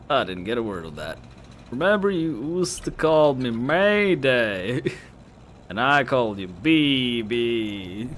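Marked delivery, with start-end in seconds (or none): 1.7-8.1 put-on slow, higher drunken drawl; sloppy drunk girl. Drunk girl to her old best friend, gushing drawl. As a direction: stretch the words in a sentimental drunk slur. Voices drunk character in game.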